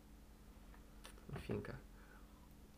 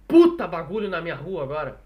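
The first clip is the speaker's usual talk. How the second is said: loud complaint; 9 dB louder than usual. Real, not acted. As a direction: complain loudly over street noise. Complaining loudly about street noise.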